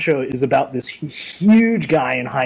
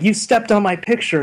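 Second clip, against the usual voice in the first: higher-pitched imitated little girl; mock upset kid, pitched up; 6 semitones higher than usual. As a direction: lightly voice a child's complaint. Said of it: "she starts crying".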